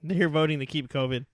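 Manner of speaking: usual speaking voice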